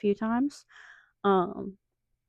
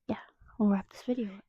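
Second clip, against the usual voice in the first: whispering; 5 dB quieter, faster than usual. Real, not acted. Whispering late at night.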